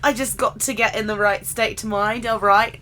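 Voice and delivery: lightly put-on Cockney-style British accent; mock British accent. Podcaster getting in the right state of mind, mock British accent. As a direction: play at being British, badly. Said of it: "they don't speak in a British accent".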